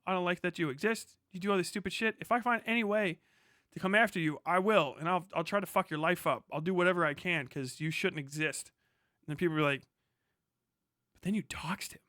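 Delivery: ordinary talk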